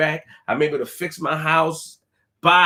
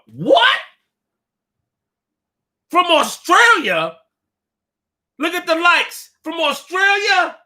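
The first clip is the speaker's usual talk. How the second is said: high, loud, slow mock disbelief; incredulous; 10 semitones higher, 4 dB louder, slower than usual. Real, not acted. Scoffing at the far-off threat, high loud disbelief. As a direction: squawk it out like you can't believe it.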